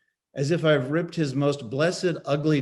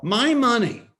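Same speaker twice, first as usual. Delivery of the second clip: My hit loud and high; stress on my; 10 semitones higher, 6 dB louder than usual. Acted. Husband yelling at his wife, stress on My. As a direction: punch My, louder, higher. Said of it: "I yell".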